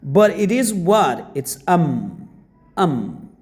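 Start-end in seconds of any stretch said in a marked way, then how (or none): 1.3-3.3 "am" said as a short weak "I'm"; contracted "I'm". Shown as a demonstration. Teacher demonstrating the short form to beginners, weak "am". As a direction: clip "It's am" down to one quick syllable. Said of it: "I am should be said".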